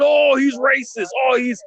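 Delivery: a high, drawn-out mocking shout; mocking accuser. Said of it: "they'd be deemed".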